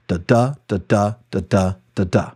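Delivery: "da" alternating unstressed then stressed, like a heartbeat; iambic da-da rhythm. Shown as a demonstration. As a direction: tap out "da, da, da" as iambic beats, weak then strong. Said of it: "That's four pairs of unstressed and stressed syllables".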